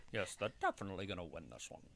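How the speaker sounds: a lightly put-on English accent, a bit fast; mock a stuck-up English commentator. Co-host trying an English accent for the chat, lightly put on. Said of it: "think of it when he says it in an English accent".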